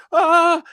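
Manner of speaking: high loud excited shout; excited yell. Retold or imitated. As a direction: let out a thrilled high yell. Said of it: "And he was like".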